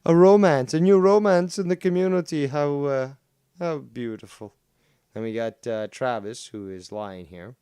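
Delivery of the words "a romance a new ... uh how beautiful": much louder, higher gushing announcement; mock excitement. Streamer teasing two chatters with a loud mock gush. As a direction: tease the pair like breaking gossip.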